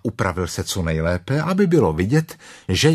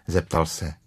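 ordinary delivery, nothing marked

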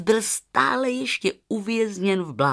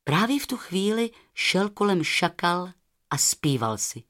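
usual speaking voice

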